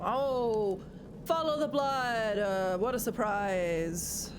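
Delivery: theatrical drawn-out exclamations; Moira. Pretend. Streamer spotting blood in the game, slow hammed-up Moira voice. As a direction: draw the vowels out like a grand stage diva. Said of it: "You sounded so much more like Moira".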